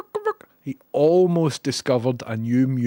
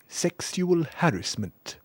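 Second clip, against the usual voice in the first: slow, higher posh English voice; posh English accent; 5 semitones higher, slower than usual. Retold or imitated. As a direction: mimic a posh English pronunciation, drawn out. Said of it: "just a posh English accent".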